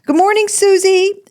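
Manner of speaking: high, loud sing-song call across the pond; shouted greeting. Retold or imitated. Mother calling out good morning to her swan. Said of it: "I would literally shout at her every morning".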